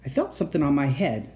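ordinary delivery

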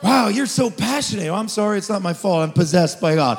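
loud, driving preacher's cry over music; full-force preaching. Acted. Pastor stirring up the congregation, shouting his sermon.

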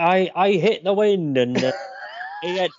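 faltering high Bristolian impression; half-hearted Bristolian accent, breaking off. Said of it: "I can't do Bristolian".

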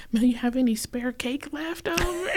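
hopeful in-law angling for leftovers; begging brother-in-law. Retold or imitated. Brother-in-law asking Mel for spare cake, pleading.

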